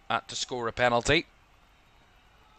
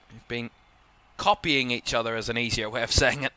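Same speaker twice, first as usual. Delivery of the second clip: ordinary delivery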